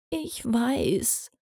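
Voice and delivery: soft, small whimpering voice. A narrator's reading. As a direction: whimper it through sniffles. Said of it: "wimmerte Olive und schniefte weiter".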